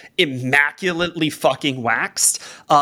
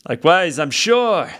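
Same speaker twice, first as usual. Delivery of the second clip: higher, brisk, lightly voiced bard; the bard pitching his news, a bit fast; Sherwood the bard; 7 semitones higher than usual. Bard tells the crew he brings news, higher and brisk.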